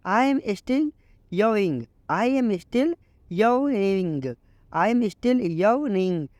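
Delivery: very slow, each English word laid out clearly; slow teaching read. Shown as a demonstration. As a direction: model the sentence slowly for learners. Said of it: "इसको आप English में बोलो".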